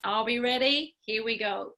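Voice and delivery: usual speaking voice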